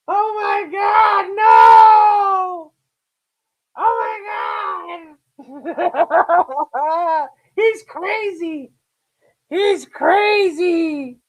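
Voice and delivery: high, drawn-out wailing meltdown imitation; hammed-up sobbing outrage, mocking the offended; mock hysteria. Acting out triggered people's hysterical meltdown.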